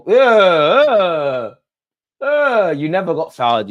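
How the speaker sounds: high, loud scoff; mocking yell. Real, not acted. Fan scoffs at an Everton player, high and loud. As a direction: shout him down with disbelief.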